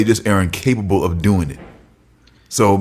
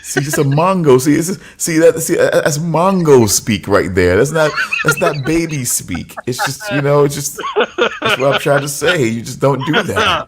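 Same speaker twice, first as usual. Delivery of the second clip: laughing; 7 semitones higher, 3 dB louder than usual. Real, not acted. Laughing rant about baby talk.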